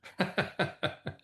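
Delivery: short, high-pitched chuckle; amused laugh. Real, not acted. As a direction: laugh at your own punchline. Laughs at own anecdote.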